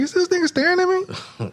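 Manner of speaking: lightly voiced suspicious woman; girl's suspicious thoughts. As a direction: imitate her side-eyeing him.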